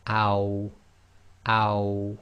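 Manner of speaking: "ow" with lips rounded into a small circle; Arabic aw with sukun. Shown as a demonstration. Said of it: "small circle with the lips".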